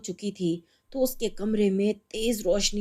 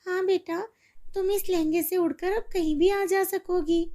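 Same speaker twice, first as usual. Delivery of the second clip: higher, pleased mother voice; sharing her joy; mother glad for her daughter; happy mother; 8 semitones higher than usual. Acted. Mother agrees.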